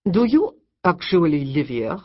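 put-on disdainful French accent. A narrator's reading. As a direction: look down on the shed in French tones. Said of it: "in a French accent as thick".

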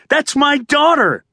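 pinned father shouting at cops; desperate shout. A narrator's reading.

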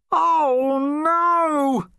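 high, drawn-out groan of dismay. A narrator's reading. Mister Pomfrey finds fishy bedclothes, dismayed groan. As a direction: groan it out, pitched well up. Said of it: "groaned Mister Pomfrey".

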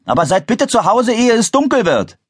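high-pitched parental call; calling back. A narrator's reading. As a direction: call the reminder out to the children. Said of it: "rief ihr Vater zurück". (hoch nachrufend)

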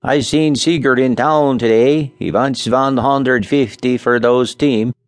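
unhurried settler's news; Scandinavian accent. A narrator's reading. Nels tells Charlie about Sigurd's team in a Scandinavian accent. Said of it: "and Nell, straightening up with a sigh, spoke".

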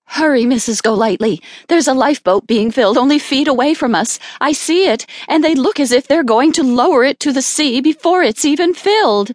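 high, urgent, excited rush of words. A narrator's reading. Miss Linden urging Isabel toward the lifeboat, urgent.

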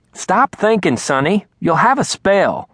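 Loretta scolding. Acted.

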